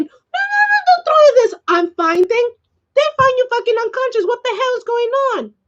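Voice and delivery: high-pitched, re-enacted telling-off; retold scolding. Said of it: "did I scold this boy".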